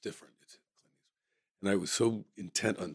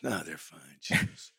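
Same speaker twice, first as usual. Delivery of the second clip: Clint quoting; 3 semitones lower, 6 dB quieter, much slower than usual. Retold or imitated. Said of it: "Clint's reply".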